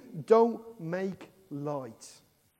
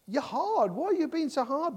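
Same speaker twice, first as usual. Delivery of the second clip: higher, pleading protest; 9 semitones higher than usual. Retold or imitated. Preacher protesting to God about the hard rebuke, higher. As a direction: complain up at God. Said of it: "I find myself thinking".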